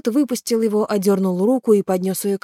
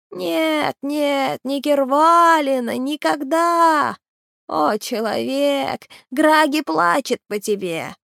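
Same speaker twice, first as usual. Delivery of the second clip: creature lament; 7 semitones higher, slower than usual. Acted. Creature Gragi answers Naal.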